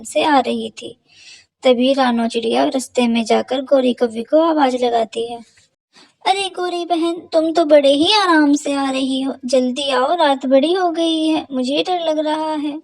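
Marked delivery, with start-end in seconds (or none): 6.2-12.8 higher, calling out uneasily; nervous sparrow. Acted. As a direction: hurry your friend along in the dark. Sparrow calls, scared at night. (ऊँची डरी हुई पुकार)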